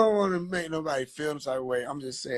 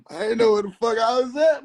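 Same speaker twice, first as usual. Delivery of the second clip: normal talking voice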